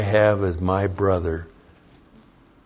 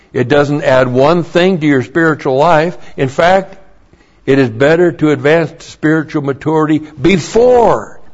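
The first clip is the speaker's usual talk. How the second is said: louder emphatic sermon delivery; preaching; 4 semitones higher, 6 dB louder than usual. Acted. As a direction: drive the doctrine home from the pulpit. Preaching the doctrine points.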